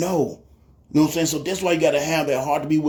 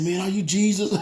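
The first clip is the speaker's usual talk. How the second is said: tearful; 3 semitones higher than usual. Retold or imitated. Hungry man given money asks his helper, mildly tearful. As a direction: ask in mild, retold tearful awe. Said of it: "He just started crying".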